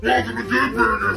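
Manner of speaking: ordinary delivery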